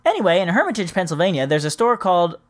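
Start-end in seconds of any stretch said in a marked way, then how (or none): none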